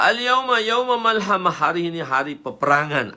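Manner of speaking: loud, high, ringing proclamation; battle cry. Acted. Companion declares a day of war at Mecca, loud cry. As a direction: proclaim war at full voice, easing at the end.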